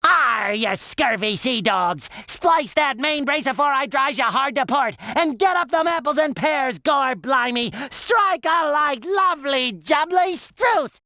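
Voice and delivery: hammed-up pirate cries, stretched at both ends; talking like a pirate. Shown as a demonstration. SpongeBob showing off his pirate lessons, hammed-up pirate voice. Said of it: "lessons in talking like a pirate".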